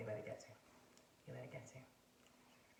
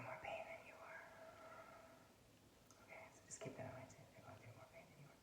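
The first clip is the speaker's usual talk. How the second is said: whisper; 11 dB quieter, faster than usual. Real, not acted. Confiding empathy in whisper.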